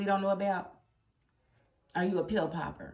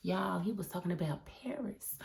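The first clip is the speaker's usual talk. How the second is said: softer, unvoiced whisper; whispered reveal; 10 dB quieter than usual. Real, not acted. Fan whispers the shocking reveal to viewers. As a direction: whisper it like a secret.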